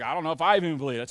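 low, rushed, unconvinced mumble; half-hearted. Retold or imitated. Pastor mimicking the congregation's weak response, half-hearted. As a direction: imitate a doubtful churchgoer, quickly. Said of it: "the way somebody said it over".

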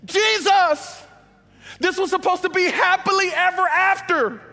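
high, loud, accusing cries; angry shout. Pretend. Hurt believers shout their unmet plans at Jesus, angry shout. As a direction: yell the broken promises up at Jesus. Congregation's complaints voiced at Jesus.

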